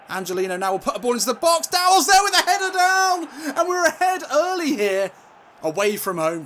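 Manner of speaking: high, loud football commentator shout; excited goal call. Real, not acted. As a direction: build fast to a soaring goal cry.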